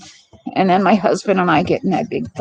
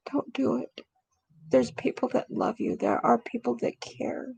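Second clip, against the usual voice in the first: hushed, near-whispered plea; soft plea; 2 semitones higher, 8 dB quieter than usual. Real, not acted. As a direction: quietly beg the viewer not to give up. Pleading with suicidal viewers.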